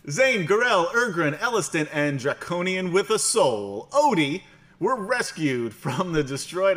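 high, loud dramatic narrator voice. Acted. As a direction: read the recap like a grand announcer. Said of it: "I've changed my, uh, my narrator voice now".

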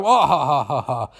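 spelt-out evil guffaw; theatrical ha-ha-ha, falling away; mock villain laugh. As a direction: fake a hammy villain's laugh.

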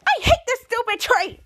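high whiny protest; childish tantrum. Acted. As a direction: throw a little tantrum. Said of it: "he was like".

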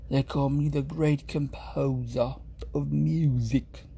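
slow, lightly posh English accent, stressing great. Shown as a demonstration. Streamer approving his own posh English delivery. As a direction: boast it like a grand English maestro. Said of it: "Yes, yeah, like that".

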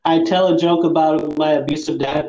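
normal talking voice

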